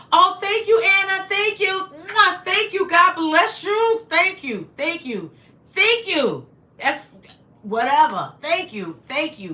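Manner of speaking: high, loud, overjoyed thank-yous; high and loud excited thanks to viewers; excited gushing thanks. Real, not acted. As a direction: gush over every gift.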